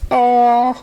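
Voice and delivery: long high disappointed "Aw"; a loud, high sigh of letdown. A narrator's reading. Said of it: "said Berry Cake".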